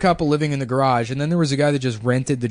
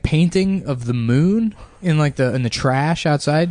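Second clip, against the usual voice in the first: ordinary talk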